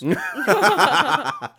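loud high laugh; high burst of laughter. Real, not acted. Reacts to teasing.

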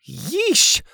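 high, stretched yelp of amazement; awed exclamation. A narrator's reading. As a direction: react wide-eyed to a dizzying height. Child amazed at fort's height.

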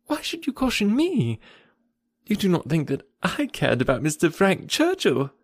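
high-pitched, incredulous questioning; taken aback and disbelieving; astonished. A narrator's reading. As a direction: protest in amazement, voice lifted. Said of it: "colouring and astonished".

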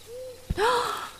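very high, theatrical fake gasp; over-the-top pretend gasp; mock gasp. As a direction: gasp in hammed-up shock. Exaggerated gasp at him.

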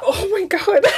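high giggly oh my God; laughing through the words; hysterical laugh. Real, not acted. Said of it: "Why are you laughing so hard?".

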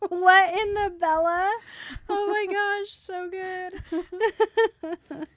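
laughing in delight. Real, not acted. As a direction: laugh helplessly through the words. Cracking up at the joke.

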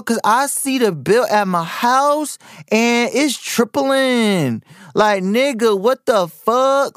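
high, drawn-out mocking street-talk rant. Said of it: "[name], she's about to be thirty years old, and she's still".

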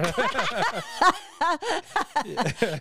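big burst of laughter. Real, not acted. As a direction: laugh out hard and high. Big laugh at own story.

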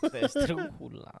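high, slow mock Spanish accent. Joking with friends about accento, mock Spanish accent. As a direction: stretch it out in a playful Spanish accent. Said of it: "You just add an accento".

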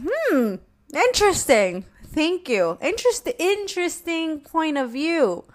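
high, drawn-out feigned interest; mock-polite. Daughter answering father's excuse for yelling, mock-polite. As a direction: pitch it up in exaggerated polite interest. Retold sassy reply to her father.

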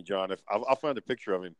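usual speaking voice

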